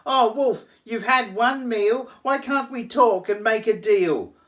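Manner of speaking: high, loud squealing piglet plea. Acted. Pig begs the wolf for a deal, squealing high. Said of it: "began to squeal".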